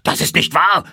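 high, loud denial; furious outburst; outraged shout. A narrator's reading. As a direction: yell it as a shocked denial. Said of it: "schrie er".

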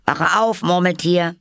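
high, loud wake-up call. A narrator's reading. Both wake the Dormouse, calling out loudly. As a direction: call out to rouse a sleeper. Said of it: "riefen beide".

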